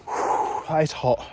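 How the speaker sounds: breathy gasps through burning mouth; panting from chili burn. Real, not acted. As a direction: pant out the heat.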